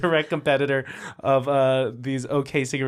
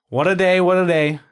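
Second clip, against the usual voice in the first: loud, raised Bronx line-read; Bronx accent; 4 semitones higher, 6 dB louder than usual. Shown as a demonstration. Director demonstrating the line for actress, Bronx accent. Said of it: "I'm from the Bronx".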